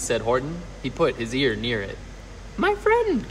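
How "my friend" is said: very high little squeak; tiny speck voice. A narrator's reading. Tiny mayor hailing the elephant, high squeaky voice. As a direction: pipe it out like a minuscule creature. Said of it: "came the voice".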